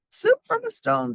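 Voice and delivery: put-on very high old lady voice, amazed. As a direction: pipe it up like a wondering granny. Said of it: "said the o-, little old lady".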